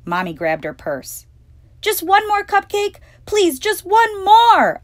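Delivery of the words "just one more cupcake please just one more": high, loud, slow pleading yell; shouting back while dragged out the door. Acted. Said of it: "I yelled".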